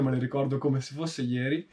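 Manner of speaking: ordinary delivery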